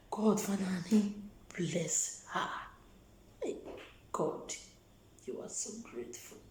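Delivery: softly whispered blessing; whispered prayer. Real, not acted. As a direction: breathe the prayer under your voice.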